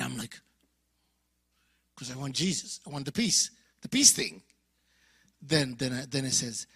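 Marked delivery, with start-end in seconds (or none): none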